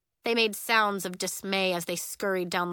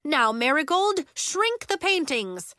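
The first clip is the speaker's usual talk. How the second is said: high, urgent teacher's order; raised, insistent instruction; commanding; 7 semitones higher, 5 dB louder than usual. A narrator's reading. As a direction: command a pupil in a crisis. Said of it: "commanded Miz Starr".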